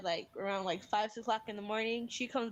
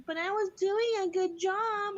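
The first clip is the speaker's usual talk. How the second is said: put-on high, louder, drawn-out child wail; mock breaking down; 9 semitones higher, 5 dB louder, slower than usual. Daughter protesting as mom takes over, high mock tearful wail. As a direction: imitate a toddler dissolving into tears. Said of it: "And she, she started breaking down".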